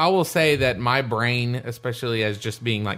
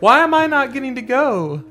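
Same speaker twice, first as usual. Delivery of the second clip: high, louder whining complaint; whiny player voice; 12 semitones higher, 5 dB louder than usual. Retold or imitated. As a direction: pester like a left-out raider. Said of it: "it'd be like".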